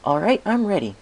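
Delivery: put-on high, loud, excited baby dragon; squeaky young dragon calling out; Spike voice. A narrator's reading. Spike eager.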